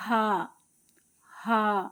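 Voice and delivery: ha breathed from the throat. Shown as a demonstration. Teacher demonstrating ha for students, breathy from the throat. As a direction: push ها from the throat. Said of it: "حلقی ہے".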